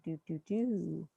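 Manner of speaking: normal talking voice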